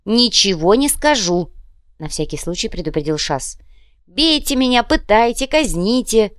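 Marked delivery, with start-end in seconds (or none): 0.0-1.5 slow, louder, higher defiance; defiant refusal. Acted. Prisoner refuses his captors, loudly defiant. 4.1-6.3 high, loud martyr's cry; melodramatic defiance. Acted. Prisoner dares captors to torture him, theatrically defiant.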